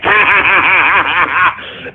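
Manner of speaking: deep villainous cackle; evil cackle. Acted. Streamer mocking trolls with an evil cackle. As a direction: laugh like a supervillain winning.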